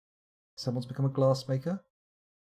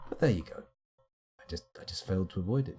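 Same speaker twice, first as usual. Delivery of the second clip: ordinary talk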